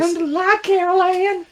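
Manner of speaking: put-on high, breathy little ghost girl; very high eerie child voice; creepy little girl voice. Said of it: "in a creepy little girl voice".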